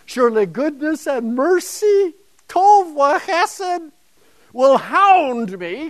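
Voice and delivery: HOUND high, loud and drawn out; emphatic HOUND. Shown as a demonstration. Lecturer showing how strong the psalm's verb is, emphatic and loud. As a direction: punch out HOUND with fierce intensity. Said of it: "It's not follow, that's far too mild".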